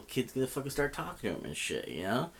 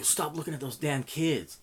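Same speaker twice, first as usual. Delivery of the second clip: higher, mock-stern order; retold confrontation; 6 semitones higher, 3 dB louder than usual. Bystander confronting a man eyeballing kids, retold sharp warning. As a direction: call him out, retelling it.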